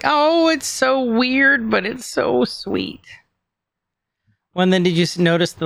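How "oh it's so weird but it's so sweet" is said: much higher, slow, gushing voice; hammed-up sweet cooing; mock gushing villain. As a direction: coo it like a smitten onlooker. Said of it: "He's like".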